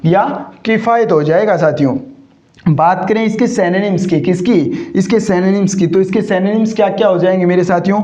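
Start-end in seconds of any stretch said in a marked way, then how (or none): none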